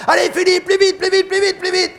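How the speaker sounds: very high, loud shout from the boat; bailiff shouting. Retold or imitated. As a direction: urge him on from afar. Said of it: "je l'entendais sur son petit bateau". (l'huissier crie depuis son bateau, très aigu)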